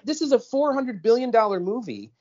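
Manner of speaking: mock disbelief at a botched dub; incredulous shock.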